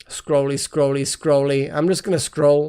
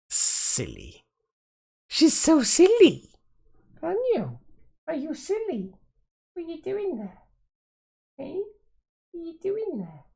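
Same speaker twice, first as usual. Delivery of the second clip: high, soft cooing pet voice; 13 semitones higher, 5 dB quieter than usual. Real, not acted. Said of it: "using a strange voice".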